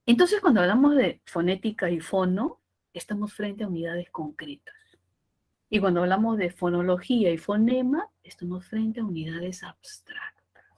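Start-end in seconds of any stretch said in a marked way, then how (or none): 2.9-4.7 whispered definition. Shown as a demonstration. Susurro didáctico. 8.2-10.6 whispered definition. Shown as a demonstration. Susurro didáctico.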